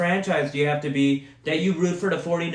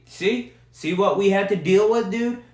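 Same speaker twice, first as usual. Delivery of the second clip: ordinary delivery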